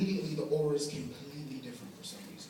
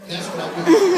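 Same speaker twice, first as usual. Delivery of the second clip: mock villain glee; 13 dB louder than usual. Scheming plan climax.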